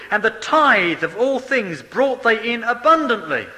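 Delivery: loud, measured preaching of the verse; preached scripture reading. Acted. Preacher reading Chronicles to his congregation, forceful preaching. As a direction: proclaim the scripture to the church.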